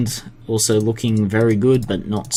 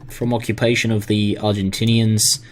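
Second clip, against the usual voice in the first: ordinary talk, nothing marked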